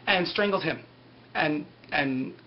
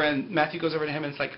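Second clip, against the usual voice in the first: ordinary delivery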